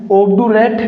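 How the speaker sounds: ऑब्डुरेट with a hard "du" in the middle; mispronounced obdurate. Shown as a demonstration. Teacher showing students the wrong reading, ऑब्डुरेट with hard "du". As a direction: say ऑब्डुरेट as "ob-du-rate", plain d. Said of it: "ऑब्डुरेट नहीं होगा".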